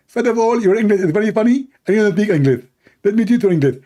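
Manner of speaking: high mock debater. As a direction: lecture him smugly, way up high. Mock opponent rebuttal.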